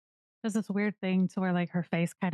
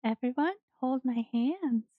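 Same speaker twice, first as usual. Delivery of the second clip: put-on high, slow child's voice; slow, high little-girl instruction; little kid; 6 semitones higher, slower than usual. Little girl character instructs group.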